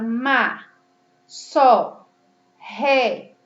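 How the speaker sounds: má, só, ré with clearly open vowels, one by one. Shown as a demonstration. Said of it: "O som, ele sai mais aberto". (má, só, ré com som bem aberto)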